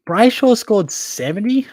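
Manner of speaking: surprised. Real, not acted. Checking a player's score on air, surprised. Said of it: "Wow, that is so surprising".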